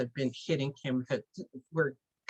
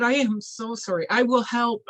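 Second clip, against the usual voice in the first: pleading apology; 6 semitones higher, 4 dB louder than usual. Acted. Helper pleads for another chance.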